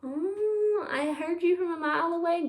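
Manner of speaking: playful encouragement. Pretend. Mock reaction to imagined pupils.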